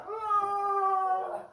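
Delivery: high, drawn-out fake bawl; mock crying wail. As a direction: bawl theatrically to outdo a child. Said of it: "I start to cry".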